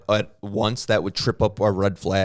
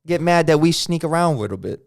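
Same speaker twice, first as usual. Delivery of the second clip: put-on Chinese accent; 7 semitones higher, 5 dB louder than usual. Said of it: "We're not bad at Chinese accents".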